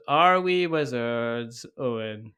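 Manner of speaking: slow, drawn-out call for an answer; leading question. Pretend. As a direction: stretch it out like a teacher prompting pupils. Host leads a mock-stern catechism.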